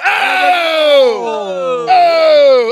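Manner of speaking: loud, high shocked cries of "oh"; shocked shouts. Real, not acted. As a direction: cry out in disbelief. Reacting to a death.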